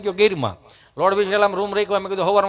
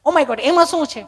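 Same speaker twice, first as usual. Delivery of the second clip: high, incredulous prissy voice; mock a city visitor astonished at needing rehearsal; affected city speaker; 8 semitones higher than usual. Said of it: "ચીપી ચીપીને Gujarati બોલે".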